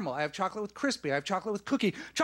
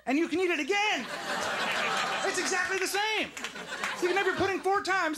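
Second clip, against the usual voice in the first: high-pitched, over-the-top sales pitch; mock excitement; 10 semitones higher than usual. Comic pitching pudding to the crowd, mock excitement. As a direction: push it up high in absurd enthusiasm.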